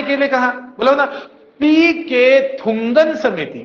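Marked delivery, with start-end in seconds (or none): 1.6-3.6 पीके थुंगन समिति drawn out; slow drill. Shown as a demonstration. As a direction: stretch the name for memory. Teacher drilling name slowly. (धीरे खींचकर बोलना)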